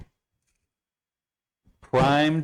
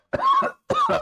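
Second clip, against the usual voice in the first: coughing fit; 4 dB louder than usual. Real, not acted. Sniffing smelling salts.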